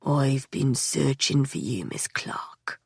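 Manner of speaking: slow drawl fading to a breath; slow lazy drawl. A narrator's reading. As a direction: stretch it out lazily, trailing off. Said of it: "he drawled".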